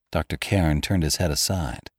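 usual speaking voice

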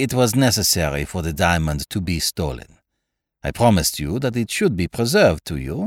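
put-on courtly French-accented detective; Poirot, gracious. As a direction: reassure with elaborate French courtesy. Poirot voiced in story.

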